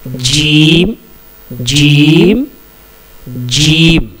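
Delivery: जीम with the middle of the tongue at the palate; Arabic letter jim. Shown as a demonstration. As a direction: model जीम for repetition. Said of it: "इसका तलफ़ुज़ है". (बीच ज़ुबान तालु पर, जीम)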